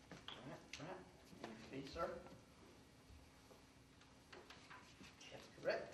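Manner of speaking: hushed whisper about the fee; whispered aside. Acted. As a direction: slip the money part in under your breath.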